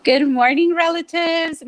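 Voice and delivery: loud, bright hello to the audience; hearty greeting. Real, not acted. Co-host greeting the viewers, loud and high on relatives. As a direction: call out a big welcome.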